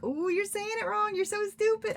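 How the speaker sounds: high-pitched, silly jeering voice; mocking scold. Author imagines scolding readers in Discord, mocking high voice. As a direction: pitch it way up like a petty know-it-all.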